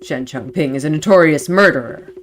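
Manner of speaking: low, stern interrogating officer; Sergeant Singh. Acted. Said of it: "you wanna be Sergeant Singh?".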